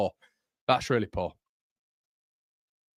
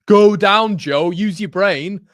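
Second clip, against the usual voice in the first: loud, higher shout at the player; yelling at the screen; 8 semitones higher, 10 dB louder, much slower than usual. Real, not acted. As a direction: bellow at him to go down.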